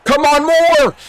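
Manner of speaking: high, loud cheering yell; excited shout. Real, not acted. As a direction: yell him on down the field. Urging runner during play.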